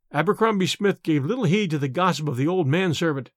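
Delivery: usual speaking voice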